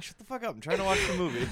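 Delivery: mock yell. Retold yelling at friend in theater.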